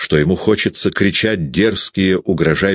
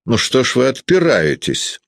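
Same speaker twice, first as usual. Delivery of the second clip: higher, a touch of anger; angry; 8 semitones higher than usual. A narrator's reading. Peredonov angrily accusing Sasha of denying it, mildly acted. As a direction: snap at the boy, lightly acted. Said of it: "сердито сказал Передонов".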